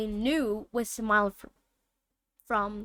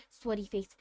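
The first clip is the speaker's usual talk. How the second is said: plain reading voice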